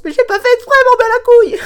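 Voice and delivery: very high, loud squealed protest; mock indignant yell. Host answering a complaint call, mock indignant squeal. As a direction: shriek the excuse in cartoonish protest. Acted panicked reply to phone complaint.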